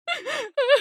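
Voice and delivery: a high, broken laugh on the edge of panic; hysterical laugh. Acted. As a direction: laugh as the fear spills over. Daughter breaking down in terror.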